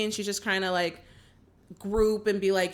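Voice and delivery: ordinary talk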